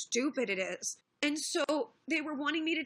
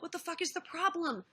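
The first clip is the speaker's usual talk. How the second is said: higher-pitched mock exasperation; playful banter; 6 semitones higher than usual. Streamer retelling Bering's banter with friends, playfully exasperated. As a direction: quote him challenging them in fun, pitch up. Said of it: "they do playful banter".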